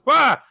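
high loud startled yelp. Retold or imitated. Girl running into him, startled high yelp. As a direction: squeal like she ran into him by surprise.

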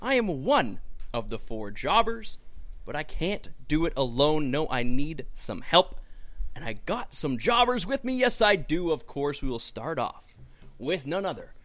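big, high opening easing down to a teasing hush; hammed-up announcer intro. Host welcoming everyone to the podcast, showy announcer voice.

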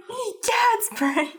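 very high cartoon sidekick, drawn out; Pinky. Host imitates Pinky exclaiming to Brain, high slow voice. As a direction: stretch it out in a squeaky goofy voice. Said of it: "pretty good Pinky impression".

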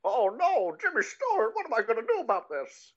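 very high, cartoonish fretful voice; badly faked, panicky film star impression; cartoon Don Knotts style fretting; Jimmy Stewart. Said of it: "the worst Jimmy Stewart ever".

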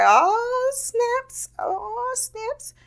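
squeaky high oh snaps, fading away; high amazed squeak. Retold or imitated. Partygoer wowed by the show, high squeaky fading amazement. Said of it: "I was like".

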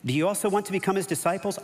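high, quick sarcastic question; heavy sarcasm. A narrator's reading. Healed man taunts the religious leaders, very sarcastic. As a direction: needle them with a mock-innocent question. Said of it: "A lot of sarcasm there".

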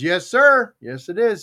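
high, loud eager yes sir; a hammed-up innocent fisherman. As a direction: chipper fisherman answering the warden. Fisherman voiced in joke.

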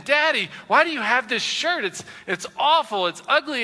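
a hint of a teasing kid; mock kids' complaint. Preacher voices his kids mocking his old shirt, lightly childlike. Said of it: "They're saying".

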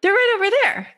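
high, quick, bright helper voice; helpful bird. Pretend. Projector bird pointing squirrel to nuts, high and quick. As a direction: chirp the answer quickly from up high. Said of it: "And you're like".

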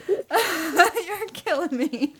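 slow, laughing movie quote. Real, not acted. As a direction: draw it out while laughing.

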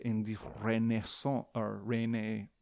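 attempted French pronunciation of Renaissance; tentative mock French accent. Shown as a demonstration. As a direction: put on a French accent, groping for it. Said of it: "more like I'm French".

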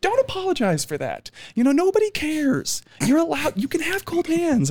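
high, bright girlish imitation; imitating her. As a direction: mimic the overly earnest woman up high. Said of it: "she was like".

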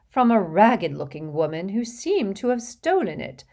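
put-on fussy, gushing rich old lady, laughing in; a vain old witch showing off to a charming boy; giggly hostess voice. Hepzibah gossiping, boastful.

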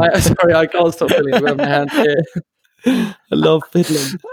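giggling through the words; can't stop chuckling; laughing. Real, not acted. Giggling at own fidgeting.

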